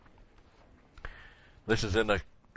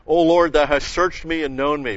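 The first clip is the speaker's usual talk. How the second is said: louder, solemn reading of the verse; scripture reading; 4 semitones higher, 7 dB louder than usual. Acted. Preacher reads verse one aloud to the church, loud and solemn. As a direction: proclaim the opening line of the psalm.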